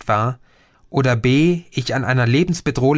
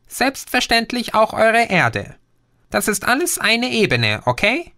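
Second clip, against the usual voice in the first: lightly voiced alien, somewhat higher; a patient know-it-all explaining; Green's voice; 9 semitones higher than usual. (leicht höher gesprochener Außerirdischer, belehrend)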